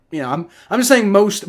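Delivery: usual speaking voice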